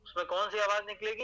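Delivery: normal talking voice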